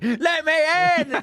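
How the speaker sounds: high mock scarecrow cry; menacing scarecrow. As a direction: imitate a scarecrow demanding entry. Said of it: "appears on the window and is like".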